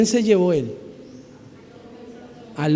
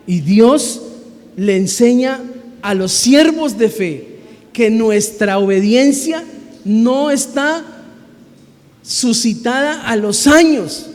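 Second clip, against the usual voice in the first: louder, rising, hammering each phrase; preaching at full force; 2 semitones higher, 4 dB louder than usual. Real, not acted. Pastor urging the church that age is no excuse - fervent. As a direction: drive the point home to the congregation.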